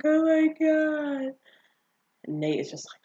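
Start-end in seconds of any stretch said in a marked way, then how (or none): 0.0-1.3 high, drawn-out mock wail; mock panicked whine. Vlogger acting out Cassie hiding in the bathroom, mock panic. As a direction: whine it slowly in a put-on high voice.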